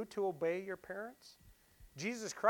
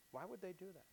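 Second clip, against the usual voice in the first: same question again, much softer and falling; softer repeat; 14 dB quieter, faster than usual. Real, not acted. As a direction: let it sink in, almost to yourself.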